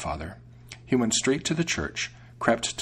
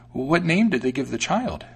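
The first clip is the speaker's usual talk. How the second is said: higher-pitched inquisitive mouse; curious mouse; 5 semitones higher, 4 dB louder than usual. A narrator's reading. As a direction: ask as the curious little mouse. Mouse questions the cat.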